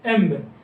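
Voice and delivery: एम्ब said as one short syllable, emb, with a closing b; compound sound mb. Shown as a demonstration. Teacher showing the class the mb blend, saying एम्ब. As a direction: close the lips on the b of एम्ब, short and falling. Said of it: "एमबी से मिलके क्या बना?".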